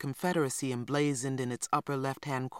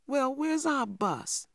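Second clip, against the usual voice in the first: put-on a small boy's high, slow demand; lightly voiced child insisting on an answer; 11 semitones higher, slower than usual. A narrator's reading. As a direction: draw it out high like an indignant little boy. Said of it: "demanded little man".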